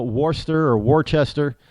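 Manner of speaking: Worcester as "WAR-ster", then "WAR-chester"; outsider mispronunciations. Shown as a demonstration. Imitating outsiders in New England, misreading Worcester. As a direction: sound every R in Worcester. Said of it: "if you say".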